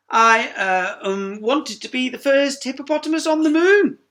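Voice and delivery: flustered hippo. Acted. Sheila flustered.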